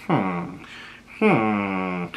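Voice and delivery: soft, low pondering hum; mock deep thinking; quiet thinking hmm; thoughtful hum. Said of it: "he's just sitting there thinking".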